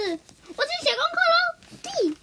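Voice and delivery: put-on very high, slow sing-song kid voice; a child happily heading to homework; 开心的小孩. 绿豆冰放学回家. (很高、拖长的开心童声)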